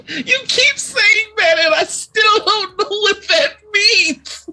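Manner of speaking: laughing disbelief. Real, not acted. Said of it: "laughing confusion".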